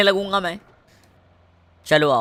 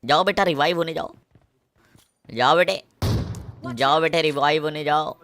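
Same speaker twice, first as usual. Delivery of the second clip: usual speaking voice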